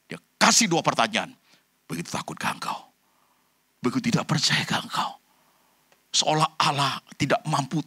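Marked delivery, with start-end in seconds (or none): 1.9-5.2 soft, almost whispered question from Jesus; hushed rebuke. Acted. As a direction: lean in and ask it under your breath. Jesus rebukes the disciples quietly. (teguran lirih, hampir berbisik)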